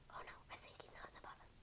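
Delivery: fast frightened whisper; panicked hushed whisper. Acted. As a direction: rush it out in a whisper. Characters fear being watched.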